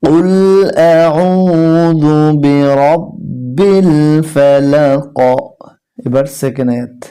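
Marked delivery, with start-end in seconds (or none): 0.0-5.2 slow, drawn-out melodic recitation; measured recitation of the verse; Quran recitation. Shown as a demonstration. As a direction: chant each word long, tajweed style.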